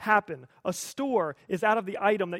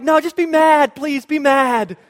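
loud, high, hammed-up begging; desperate pleading; 7 semitones higher, 14 dB louder, slower than usual. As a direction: beg at full volume like an anguished kid. Child begs mom to be mad.

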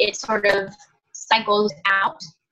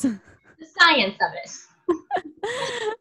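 laughing; 8 semitones higher, much slower than usual. Real, not acted. Laughing embarrassed about topic.

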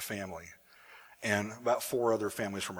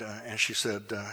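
normal talking voice

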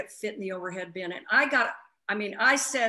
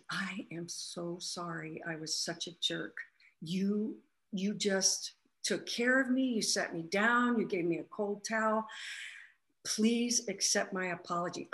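hushed apology; 3 semitones lower, 6 dB quieter than usual. Retold or imitated. Passenger apologizing to the flight attendant, hushed and contrite. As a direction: say sorry quietly, humbled and ashamed.

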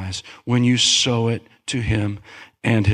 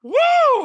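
joyful whoop; 4 dB louder than usual. Real, not acted. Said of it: "calls up the worship team".

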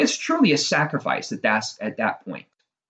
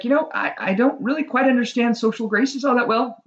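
much higher, nerdy voice, stumbling at the start; awkward nerd; 11 semitones higher than usual. A narrator's reading. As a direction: play the socially clumsy nerd, pitched way up. Nerd answers the frog awkwardly.

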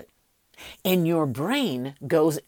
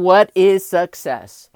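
loud, slow question; 9 dB louder, slower than usual. Shown as a demonstration. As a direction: boom out what is success slowly. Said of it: "That's the first question".

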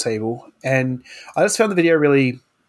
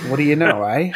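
put-on Canadian accent. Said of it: "Can't forget the A".

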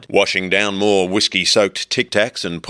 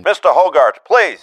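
high, louder plea; pleading shout; 9 semitones higher, 6 dB louder than usual. A narrator's reading. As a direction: beg him to answer.

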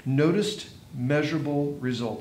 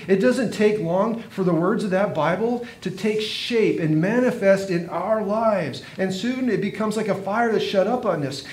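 building sermon climax, pitch climbing; preaching; 4 semitones higher than usual. Acted. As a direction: lift the room toward the call to act.

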